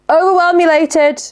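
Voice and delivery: high exclaim. Retold or imitated. Telling how she talks to Lucy.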